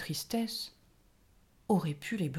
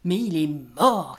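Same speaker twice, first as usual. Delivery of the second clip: much louder, slow booming gnome voice; jolly gnome; 3 semitones lower, 11 dB louder, slower than usual. A narrator's reading. The gnome cheerfully corrects the grieving fairies, loud and slow. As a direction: boom it out like a jovial gnome.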